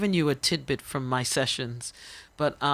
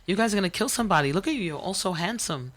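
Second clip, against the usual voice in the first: put-on scolding kids warmly; 6 semitones higher, 3 dB louder than usual. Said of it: "I said".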